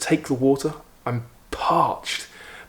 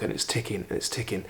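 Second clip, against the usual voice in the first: hushed; 2 semitones lower, 9 dB quieter than usual. Retold or imitated. Nervous wait, clock ticking.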